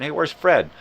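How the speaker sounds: high, loud call of inquiry. Acted. Friend asking around after missing Fred, calling out.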